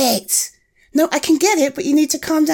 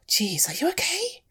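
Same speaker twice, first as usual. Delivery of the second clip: soft whisper, much higher on the question; concerned whisper; 7 semitones higher, 8 dB quieter than usual. Real, not acted. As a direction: lean in and whisper your worry. Checking on the scared friend.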